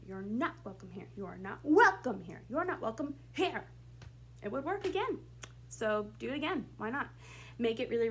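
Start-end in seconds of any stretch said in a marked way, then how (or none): 0.1-1.1 "not" picked out; stress on not. Shown as a demonstration. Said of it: "pick a word in that sentence as well to emphasize". 1.2-2.4 "welcome" punched loud; stress on welcome. Shown as a demonstration. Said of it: "pick a word in that sentence as well to emphasize". 2.5-3.7 "here" set apart and raised; stress on here. Shown as a demonstration. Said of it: "pick a word in that sentence as well to emphasize".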